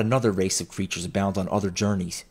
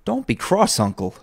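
high, lively plea that drops on uncle; coaxing; 11 semitones higher, 4 dB louder than usual. A narrator's reading. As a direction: coax a cross uncle. Nephew coaxes his cross uncle.

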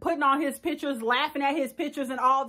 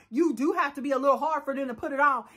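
usual speaking voice

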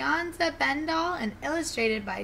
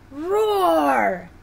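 higher, louder drawn-out roar; lion roar; 6 semitones higher, 7 dB louder than usual. Acted. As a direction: let the word swell into a roar. Roar builds to louder demonstration.

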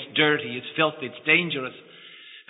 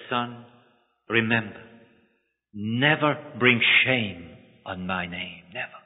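soft, slow, lower; tender solemn reminder; 4 semitones lower, 4 dB quieter, slower than usual. Acted. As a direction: say it slowly like a blessing. Father's parting charge to son.

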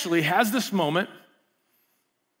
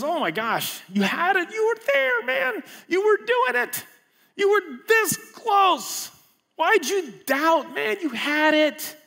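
high, slow, thrilled encouragement; excited coach voice, high and drawn out; excited Jesus; 13 semitones higher, slower than usual. Retold or imitated. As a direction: cheer a friend who almost made it. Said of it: "He's excited about it".

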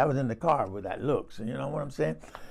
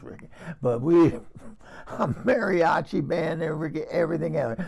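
ordinary talk, nothing marked

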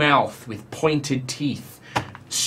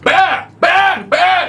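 high, loud panicked yells; frightened god shouting at a bear; 14 semitones higher, 5 dB louder, much slower than usual. Acted. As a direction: yell the word over and over in alarm.

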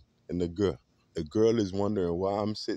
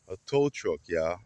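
usual speaking voice

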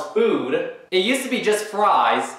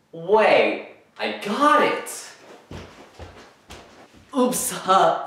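ordinary talk, nothing marked

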